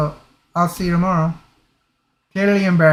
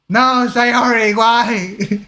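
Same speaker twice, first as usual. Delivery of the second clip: drawn-out, higher-pitched playful lament; mock dismay; 6 semitones higher, much slower than usual. Player lamenting Sayori's bad timing, mock dismay. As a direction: plead in mock despair, stretching the words.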